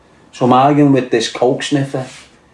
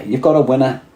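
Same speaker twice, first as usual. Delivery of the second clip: ordinary delivery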